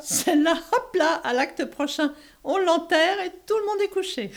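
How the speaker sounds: high, loud giggling scorn; mock the play through giggles; giggling mockery.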